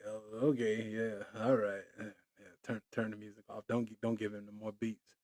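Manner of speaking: low, soft, awkward brush-off; muttered plea to cut the beat; mock embarrassed. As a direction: quietly wave the music off. Imitating embarrassed listeners.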